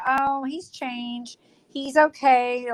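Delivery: drawn-out, mock-naive; slow mock reassurance. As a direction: stretch it out, fake trusting. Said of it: "remember I was telling you".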